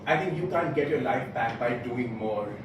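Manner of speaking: ordinary talk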